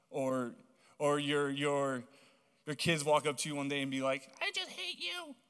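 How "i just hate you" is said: put-on much higher, childish whiny voice; mock kid. Preacher imitating a child telling a parent they hate them, high and whiny. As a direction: whine it like a sulky child.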